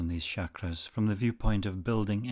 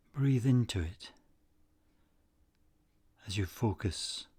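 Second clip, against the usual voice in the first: unhurried, soothing delivery, letting each phrase settle; guided meditation. Acted. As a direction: guide them gently into stillness, phrase by phrase.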